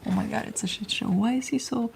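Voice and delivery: ordinary delivery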